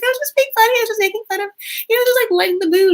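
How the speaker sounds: mock mean girl excuse. Mean older sister excuses her teasing, mock innocent and high. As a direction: play the mean girl brushing it off.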